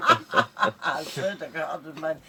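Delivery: slow words squeezed through laughter; real laughter running through the words; laughing. Real, not acted. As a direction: swear it while still laughing. Laughing after recalled trick.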